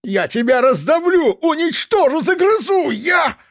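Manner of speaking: high screaming fury building to a peak; raging threat. A narrator's reading. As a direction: scream threats until you choke off. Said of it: "Он осёкся и начал багроветь".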